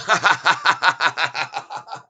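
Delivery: gleeful mocking laugh; real laugh at the shorts; rising, delighted cackle; laughing at them. Real, not acted. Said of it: "I have to laugh at 'em".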